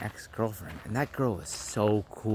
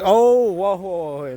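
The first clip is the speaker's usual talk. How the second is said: high, loud burst that quickly eases off; alarmed protest; 6 semitones higher, 8 dB louder, slower than usual. Retold or imitated. Son objecting to mom bringing his brother, alarmed protest. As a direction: cut in with mock alarm, then back down.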